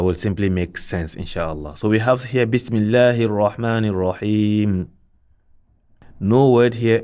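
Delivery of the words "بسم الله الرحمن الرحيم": Quran recitation. Acted. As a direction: recite it out with Tajweed. Recites basmala.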